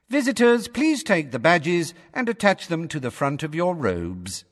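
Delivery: high, cool recorded-greeter voice; cool female voice. A narrator's reading. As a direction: welcome visitors in a cool, official female voice. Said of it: "said the cool female voice".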